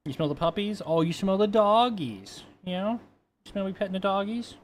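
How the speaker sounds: bright sing-song to the cat; high pet talk. Real, not acted. Owner teasing her cat about the dogs' smell, high baby talk.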